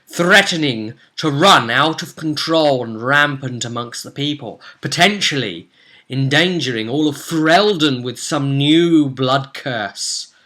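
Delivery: grand story narrator, slowing toward the end; dramatic recap narration. A narrator's reading. Recapping the cursed party's peril, dramatic narration. Game recap scene setting.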